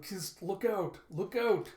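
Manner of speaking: high, loud alarm; urgent "look out" cry; shouted warning. Acted. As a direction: warn of sudden danger.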